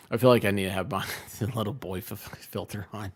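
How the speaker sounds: normal talking voice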